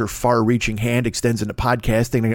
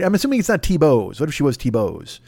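ordinary talk, nothing marked

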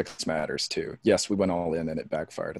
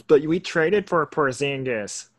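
slow, higher, louder mocking whine; mock smart-ass; 7 semitones higher, 4 dB louder, slower than usual. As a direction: ape a know-it-all's objection, slowly. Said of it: "some smart ass goes".

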